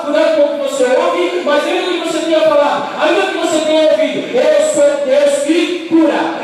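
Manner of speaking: alto, intenso, quase cantado no fim; pregação inflamada. Acted. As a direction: clamar a promessa de Deus. Preaching climax with music. (pastor clamando a cura de Deus sobre a igreja)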